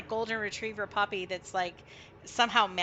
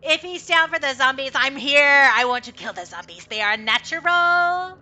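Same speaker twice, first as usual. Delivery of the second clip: higher, louder happy puppy voice; an excited dog bragging; eager dog; 4 semitones higher, 11 dB louder than usual. Acted. The good boy answers Kai, loud eager puppy voice.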